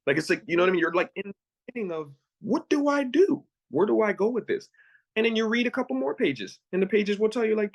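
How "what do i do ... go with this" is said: high-voiced retold bewilderment; mock helplessness. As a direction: imitate someone at a loss, pitched up. Acting out being lost at the start.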